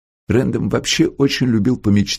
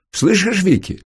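much higher, calling out; raised voice; 11 semitones higher than usual. A narrator's reading. Lawrence calls to his sister behind the screen, raised voice. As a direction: call across to someone in a raised pitch. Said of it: "Он повысил голос".

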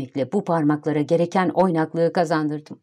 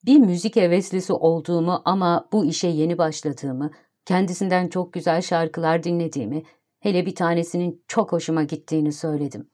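ordinary delivery, nothing marked

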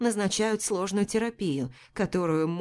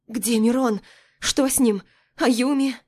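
high, loud frightened questions; panicked; 5 semitones higher, 6 dB louder than usual. A narrator's reading. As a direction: ask in rising panic. Panicked questions after waking.

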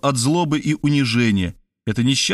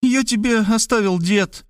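hurt squeak; 10 semitones higher than usual. A narrator's reading. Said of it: "обиженно пискнула Кристина".

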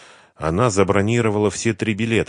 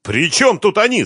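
much higher, louder narrated shout; acted yell; 7 dB louder than usual. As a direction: yell the question at him. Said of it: "заорал Юрий".

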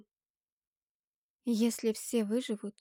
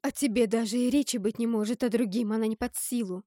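loud, raised, swooping protest; indignant refusal; 3 semitones higher, 7 dB louder than usual. A narrator's reading. Narrator refusing to release such power, loud and indignant.

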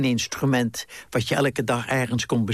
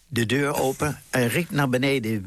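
usual speaking voice

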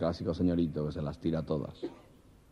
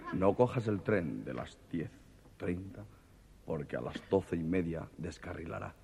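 a mysterious voice warns him off the train; ominous voice; 7 dB quieter than usual. Acted. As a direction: drop the voice like a ghostly warning.

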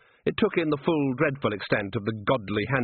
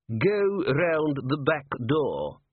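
very slow, superior dismissal; higher-pitched, drawn-out disdain; haughty herald; 5 semitones higher, much slower than usual. A narrator's reading. Herald sending Nobby away from the gate, haughty. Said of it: "aware there were degrees of nobility".

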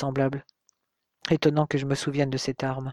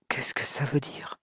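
hushed stunned whisper, softer; 6 dB quieter than usual. A narrator's reading. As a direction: breathe the question in disbelief. Stunned hero as stranger appears.

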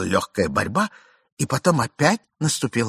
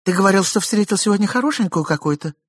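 higher suspicious wife voice; jealous wife; 10 semitones higher, 3 dB louder than usual. A narrator's reading. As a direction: probe him with jealousy. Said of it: "спросила жена".

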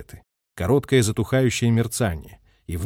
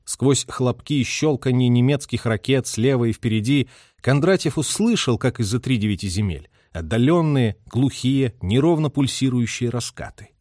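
usual speaking voice

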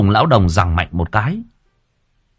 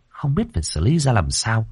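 ordinary delivery

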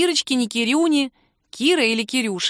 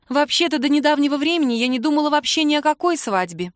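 Kira hesitantly answering, voiced a little higher; hesitant, higher female voice; 6 semitones higher, 3 dB louder than usual. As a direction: speak up higher, unsure of yourself. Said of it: "Кира отвечала тоже немного нерешительно".

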